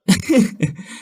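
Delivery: high scornful burst of laughter; mocking laugh. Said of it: "Con lụa nghe vậy thì bật cười".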